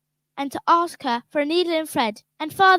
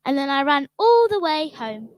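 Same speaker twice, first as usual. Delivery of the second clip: normal talking voice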